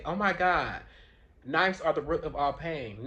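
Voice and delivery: hammed-up alarm, drawn out slowly; mock outrage. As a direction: exclaim it like an absurd, overblown accusation. Mocking what people don't say.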